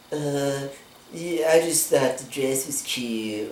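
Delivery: slow, lightly voiced indifferent girl; mock airy girl. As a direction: shrug it off like she doesn't care. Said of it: "And she says".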